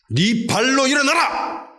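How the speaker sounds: high, slow, stretched cry; savage shout. Retold or imitated. Preacher acting Paul commanding the lame man to rise, savage shout. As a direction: command him to stand, barbarically. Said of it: "야만적으로 외쳤어요".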